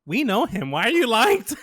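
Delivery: high, loud retold protest; exasperated disbelief. Teller replays challenging a lying friend, loudly exasperated.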